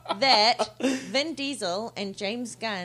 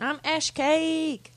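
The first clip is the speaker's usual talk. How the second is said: high, loud, stretched-out name announcement; drawn-out sign-off; 6 semitones higher, 7 dB louder, much slower than usual. Real, not acted. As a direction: draw your name out big and high. Playful name sign-off in intro round.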